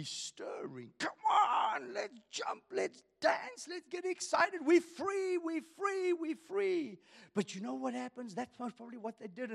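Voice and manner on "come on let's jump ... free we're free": high, excited calls to jump and dance; excited rejoicing. Pretend. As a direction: celebrate freedom like a freed slave. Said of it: "I would be leaping".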